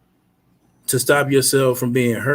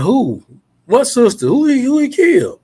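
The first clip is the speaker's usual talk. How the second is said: rising higher and higher in shock; retold shocked questions after hearing of the killing; disbelieving alarm; 11 semitones higher than usual. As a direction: grasp at the news in panic. Retold hearing sister was killed.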